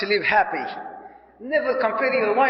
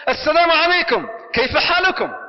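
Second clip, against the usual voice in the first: bright, raised and louder greeting; cheerful greeting; 7 semitones higher, 4 dB louder than usual. Shown as a demonstration. Coming home and greeting the family with a cheerful call. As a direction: greet the family joyfully as you walk in. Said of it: "the first thing he used to say".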